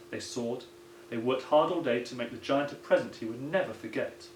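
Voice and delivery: plain reading voice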